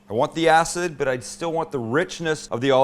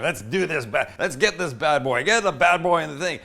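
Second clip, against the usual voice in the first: mocking imitation; 2 semitones higher than usual. Mocking people who say bad boy.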